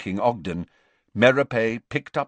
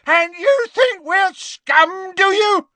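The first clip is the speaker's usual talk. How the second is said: slow, high, loud scream; enraged scream; 8 dB louder, slower than usual. A narrator's reading. Gaunt advancing on Ogden, screaming in rage. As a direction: scream it as an accusation. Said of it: "screamed Gaunt".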